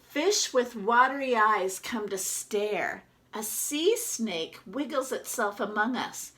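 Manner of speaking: lively storybook reading as the duck; reading to children. Acted. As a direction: read it out to the class like a picture book. Teacher reads picture book aloud.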